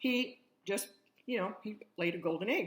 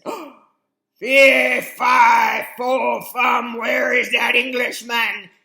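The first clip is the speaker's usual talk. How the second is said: higher, much louder, slow giant shout; an angry giant shouting, first try; 5 semitones higher, 16 dB louder, much slower than usual. Acted. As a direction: bellow after the thief. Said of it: "the giant woke up and he said".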